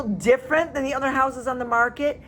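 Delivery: normal talking voice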